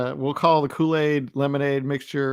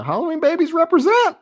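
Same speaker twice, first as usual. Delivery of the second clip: proud cheer; 4 dB louder than usual. Real, not acted. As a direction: call it out proudly. Cheering for Halloween birthdays.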